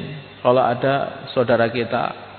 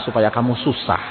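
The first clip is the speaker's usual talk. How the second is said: ordinary delivery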